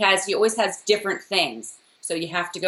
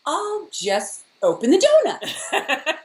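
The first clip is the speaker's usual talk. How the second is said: slow, higher-pitched acted line; movie character quote; 9 semitones higher, slower than usual. Co-host quoting Claire for a name-that-movie game, slow acted voice. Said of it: "and Claire was like".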